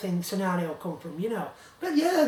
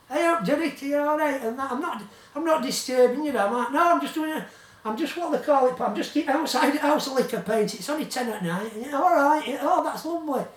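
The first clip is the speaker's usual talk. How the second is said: lightly voiced colleague, higher and louder; voicing Paul; 6 semitones higher, 5 dB louder than usual. Retelling his colleague's cheery night call, lightly voiced higher. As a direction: put on his cheerful caller voice.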